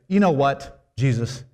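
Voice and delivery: loud, high, exasperated mock complaint; mock critical Mary. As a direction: scold the couple with theatrical dismay. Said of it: "She didn't say".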